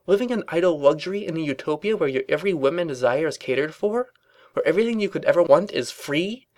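high, pressing, incredulous questioning; impassioned rant. A narrator's reading. Twilight challenges Rarity about utopia, impassioned and high. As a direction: build a fervent challenge to a friend. Ranting against life under the princess.

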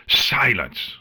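high, commanding angry yell. A narrator's reading. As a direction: cut them off with a sharp high cry. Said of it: "Chrysalis yelled".

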